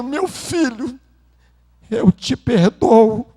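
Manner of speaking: father weeping. Retold or imitated.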